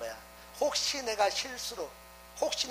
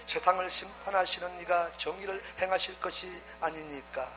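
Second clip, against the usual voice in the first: measured, lower Bible reading voice; scripture reading; 5 semitones lower than usual. Acted. Preacher reading Genesis to the congregation, in a measured reading voice. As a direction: read the verses steadily, a little lower. Reads scripture passage aloud.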